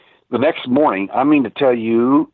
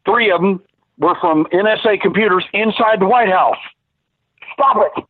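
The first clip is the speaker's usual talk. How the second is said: retold furious rant, much higher pitch; 9 semitones higher than usual. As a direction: imitate the angry webmaster yelling. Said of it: "He was furious".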